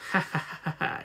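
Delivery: soft unvoiced evil chuckle; airy sinister ha ha ha; breathy villain laugh. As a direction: gloat under the breath. Hidden monster laughs.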